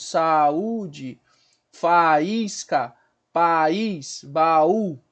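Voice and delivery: "Saúde, faísca, país, baú", each stretched with the vowels split; syllable separation. Shown as a demonstration. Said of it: "Então eu tenho que separar". ("Saúde, faísca, país, baú" separando bem as vogais)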